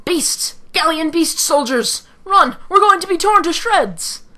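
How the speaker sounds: high, loud cries of alarm; hammed-up battlefield panic; panicked soldier. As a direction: scream a warning and flee.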